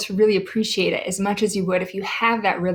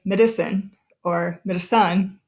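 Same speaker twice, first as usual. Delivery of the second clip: "medicine or medicine" as Madison stressed off the first syllable, ma-DI-son, then ma-di-SON; misplaced stress. Shown as a demonstration. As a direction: shift the weight in "medicine or medicine" to middle, then end. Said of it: "We don't say".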